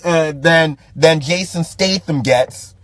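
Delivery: usual speaking voice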